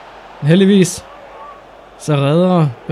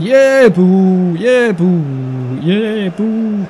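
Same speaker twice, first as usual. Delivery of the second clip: imitating crowd; 2 semitones higher, much slower than usual. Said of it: "Og sådan".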